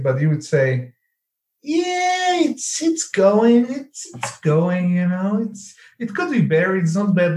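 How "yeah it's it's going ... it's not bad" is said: higher, slow and drawn out, mock reluctant; imitating a grudging Eastern European reply; mock weary answer. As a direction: sigh out a so-so answer as if nothing is ever great. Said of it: "That's, that's a standard answer".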